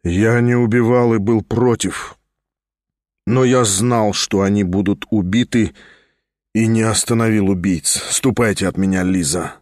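low grave confession. A narrator's reading. Stavrogin confesses to Liza and sends her away, low and fading. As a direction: let it sink, almost to nothing.

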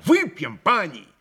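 loud, high rallying call; крик. A narrator's reading. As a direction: cry out a toast invitation, building as you go. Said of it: "крикнул Митя". (громко зазывая выпить)

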